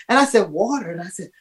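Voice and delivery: ordinary talk, nothing marked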